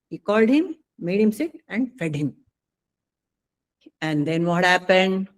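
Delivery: usual speaking voice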